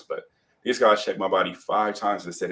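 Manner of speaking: ordinary delivery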